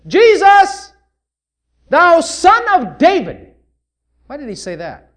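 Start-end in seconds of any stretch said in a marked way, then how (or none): 0.1-3.5 high, loud, slow call; loud drawn-out plea; crying out. Acted. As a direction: plead across the crowd. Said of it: "He says".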